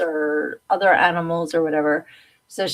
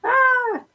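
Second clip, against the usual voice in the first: sudden high yelp; startled yelp; 4 dB louder than usual. Real, not acted. A startled high yelp at a terrible camera look. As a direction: squeal in surprise.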